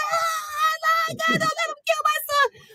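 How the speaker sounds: very high anguished cry into a pillow; crying out to God in raw panic, muffled by a pillow; panicked screaming. Retold or imitated. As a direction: scream it out, terrified he'll kill your son. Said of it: "was screaming".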